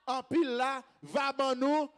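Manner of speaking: loud, emphatic congregational preaching; rousing preacher's call; full-force preaching. Real, not acted. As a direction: drive each phrase out to the crowd.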